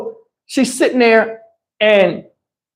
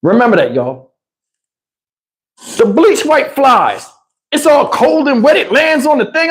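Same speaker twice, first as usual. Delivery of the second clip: normal talking voice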